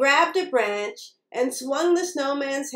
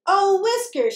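higher-pitched cry of dismay, lightly acted; mild, high exclamation; storybook cry; 7 semitones higher, 3 dB louder than usual. A narrator's reading. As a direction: cry out as a struggling little mouse. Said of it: "she cried".

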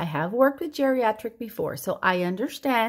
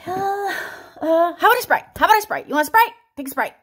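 put-on much higher, louder old father pushing Sprite; old dad; 9 semitones higher, 7 dB louder, much faster than usual. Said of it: "This is my dad".

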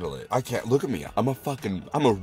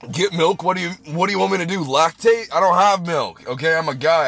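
high, louder exasperated comeback; indignant retort; 6 semitones higher, 4 dB louder than usual. Real, not acted. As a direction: snap back at useless advice. Indignant retort to chat advice.

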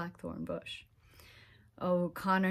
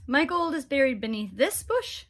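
higher, louder leprechaun voice; sly fairy man, pitched up; 6 semitones higher, 6 dB louder than usual. Acted. Leprechaun revealing the spot.

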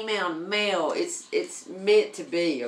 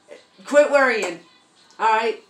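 emphatic urging; 4 semitones higher, 7 dB louder than usual. Real, not acted. Urges viewers to stop worrying.

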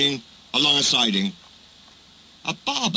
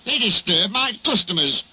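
higher, indignant complaint; fuming; 6 semitones higher than usual. A narrator's reading. Barber complaining about engines, fuming. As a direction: protest huffily. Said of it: "he fumed".

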